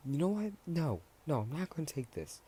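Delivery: high-pitched mock indignation; lightly acted protest, pitched right up; indignant refusal. Acted comeback in a cursing match.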